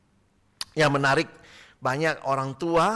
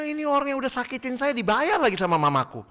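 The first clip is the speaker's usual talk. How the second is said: put-on high, fast outraged little kid; child outraged that mom pays the doctor, high and fast; indignant child; 9 semitones higher, faster than usual. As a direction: complain like a wronged toddler.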